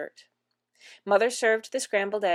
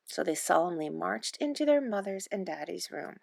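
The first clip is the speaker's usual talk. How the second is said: ordinary delivery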